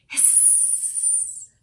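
long unvoiced hiss; snake hiss. A narrator's reading. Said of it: "just like a snake".

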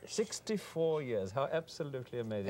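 lightly voiced posh Oxford type marvelling; posh Hooray Harry. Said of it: "I went to Oxford".

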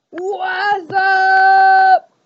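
loud drawn-out what's up; loud greeting. Acted. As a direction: call it out big. Calling out to mirror.

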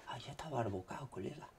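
fast hushed whisper. Real, not acted. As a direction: whisper it quickly. Whispered repeat of line 119.

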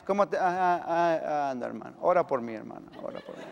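drained mumble. Acted.

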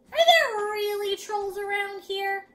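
high, loud, slow question; wondering child. A narrator's reading. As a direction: ask like a wide-eyed little boy. Boy asks father, story dialogue.

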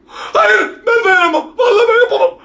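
high, crying cries of refusal; panicked whining. Real, not acted. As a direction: beg off in real fear. Refusing to look under bed.

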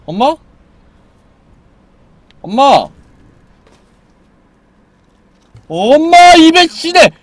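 high, loud panicked yell; frantic loud calling; yelling at mom. Real, not acted. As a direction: shout at mom in alarm.

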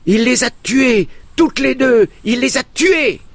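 put-on very high, loud triumphant child's shout; boy triumphantly shouting his father's success; shouting with all his might. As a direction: scream it to the whole valley. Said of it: "je criai de toutes mes forces".

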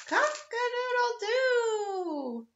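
high, slow rooster crow. Acted. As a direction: stretch it out like a proud rooster. Rooster crowing in story.

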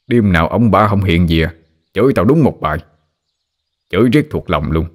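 usual speaking voice

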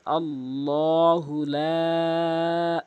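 লা held for three alif counts, slow; লা drawn out long. Shown as a demonstration. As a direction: stretch লা long, three beats. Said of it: "লা কিন্তু অবশ্যই তিন আলিফ টেনে পড়তে হবে".

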